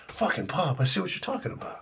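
muttered realization; quiet admission; soft aside. Retold or imitated. Realizing dad was right.

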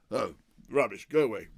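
loud brush-off, rising; gruff go-away bark; dismissive shout. Retold or imitated. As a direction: wave them away loudly. Oliver brushing off crew member.

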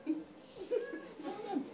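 laughing high. Real, not acted. Pastor laughing, urging his wife to tell them. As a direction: giggle it out high and light.

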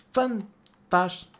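slow, drawn-out sarcastic praise; sarcastic fantastic. Real, not acted. Streamer to his chat, drawn-out sarcastic fantastico. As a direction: stretch it out, dripping with irony.

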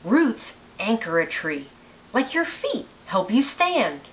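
plain reading voice

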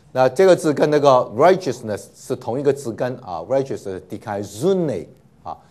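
ordinary delivery

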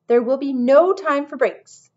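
loud, high, drawn-out proclamation; excited. A narrator's reading. Lizard manager ruling out breaks to the staff, excitedly. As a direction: announce it excitedly, slow and loud. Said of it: "the lizard said excitedly".

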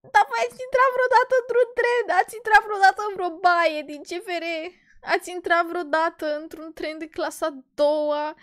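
high, fast, hammed-up outrage; mock outraged. Real, not acted. Vlogger asking viewers about train bathrooms, high fast outrage. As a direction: shriek the questions in disbelief.

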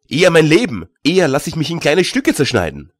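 put-on a young man's heated refusal; indignant outburst. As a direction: fling it back, defiant.

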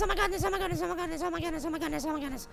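rapid chant fading low and soft; panicked muttering. Real, not acted. Player running from the rake, panicked muttering. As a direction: repeat it breathlessly fast, sinking to a mumble. Fleeing in dread.

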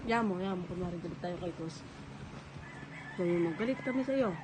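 hushed aside. Real, not acted. Parent coaching child in secret.

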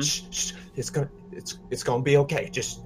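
hushed, halting shushing that grows firmer; urgent reassurance. Acted. Soldier to his crushed comrade while prying the door, urgently soothing. Player comforts a dying comrade.

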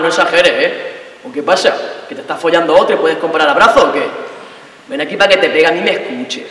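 put-on low, swaggering boyfriend voice; a hint of a macho boyfriend showing off; mock cocky boyfriend.

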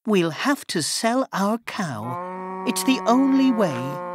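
high, anxious mother pleading to her son; mother's voice. A narrator's reading.

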